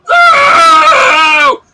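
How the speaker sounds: very high, very loud shouted no; furious shout. Acted. Negotiator turns on the players with a high furious scream. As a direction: scream it before you shoot. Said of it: "the guy you were negotiating with goes".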